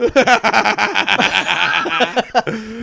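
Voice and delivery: big, high burst of laughter; long, loud belly laugh; loud cackle. Real, not acted. Host cracking up at his own get-out line, loud cackle.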